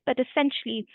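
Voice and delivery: ordinary talk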